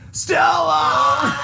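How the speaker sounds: high, loud cry of a name; shouting Stella. Retold or imitated. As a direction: belt the name at the top of your lungs. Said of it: "she's like".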